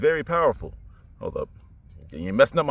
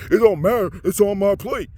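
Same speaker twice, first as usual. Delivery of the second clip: mock careless voice; 5 semitones higher, 5 dB louder than usual. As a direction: imitate a dismissive eater. Mocking careless meat eater.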